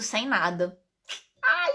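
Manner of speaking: usual speaking voice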